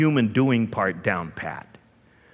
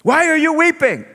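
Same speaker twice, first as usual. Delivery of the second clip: very high, loud teasing call; playful Jesus; 9 dB louder than usual. Acted. Jesus teasing heartbroken Mary at the tomb, very high. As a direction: playfully hold back the joke. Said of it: "wants to play".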